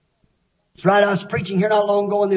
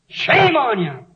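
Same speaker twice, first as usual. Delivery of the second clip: scolding; 2 semitones higher, slower than usual. Real, not acted. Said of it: "Not scolding you".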